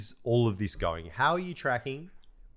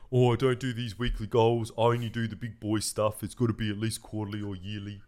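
mock English accent. Co-host mimicking Ben dismissing weekly goals, mock English accent. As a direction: imitate a friend in an English accent. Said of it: "you got an English accent".

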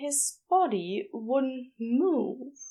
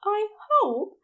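kindly nurse; 6 semitones higher than usual. A narrator's reading. Nurse Aziraphale greeting injured Crowley, cheerful and soothing. Nurse figure voiced in story.

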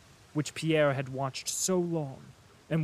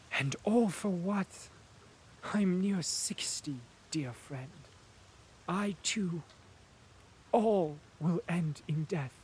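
sincere and weak; 3 semitones higher than usual. A narrator's reading. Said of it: "there was in his voice a sincerity and weakness".

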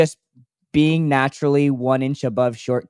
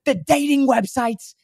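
raspy bagel guy; 11 semitones higher than usual. Retold or imitated. Bagel guy griping about dating sites, high rasp. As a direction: complain in a squeaky strained rasp. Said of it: "and he's like".

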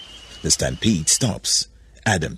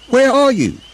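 high, loud searching shout; calling out; 9 dB louder than usual. A narrator's reading. Adam searching the cave for Eve, calling loudly. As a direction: holler for someone out of sight.

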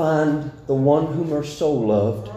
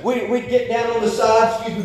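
ordinary talk, nothing marked

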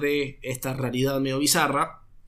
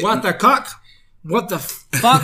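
louder, higher mock disbelief; mock outrage; 4 semitones higher, 4 dB louder, slower than usual. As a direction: ham up a baffled what the. Mock reaction to the line.

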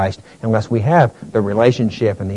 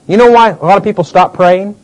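usual speaking voice

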